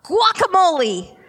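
loud excited shout. Retold or imitated. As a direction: shout it out with gusto. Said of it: "You wanna shout".

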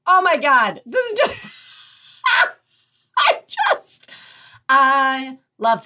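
excited. Real, not acted. Host thrilled by tip.

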